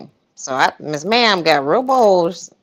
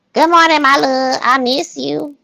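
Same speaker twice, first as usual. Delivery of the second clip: Ernest voice; 6 semitones higher, 3 dB louder than usual. Shown as a demonstration. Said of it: "my Ernest voice in".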